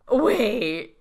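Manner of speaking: loud, slow theatrical disbelief; laid on thick. Retold or imitated. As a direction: ham up the shock, drawing out each word. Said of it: "She lays it on so thick".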